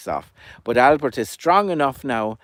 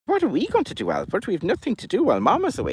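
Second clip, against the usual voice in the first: high, quick little chick voice; high chirpy chick asking Albert what to do; 10 semitones higher, faster than usual. Acted. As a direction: pipe it up like a tiny bored chick. Chicks ask Albert for help.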